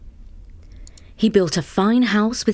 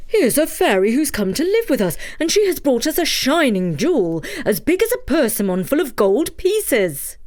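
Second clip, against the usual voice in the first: put-on higher, eager old woodcutter; excited old man; 6 semitones higher than usual. Takitori telling his wife the news, higher and eager. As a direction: burst out the news.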